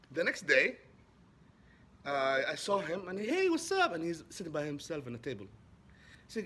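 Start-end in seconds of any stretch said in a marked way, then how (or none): 3.2-3.9 much higher, louder friendly "Hey, what's up?"; cheery greeting. Retold or imitated. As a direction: call out a bright hello. Greeting the man again.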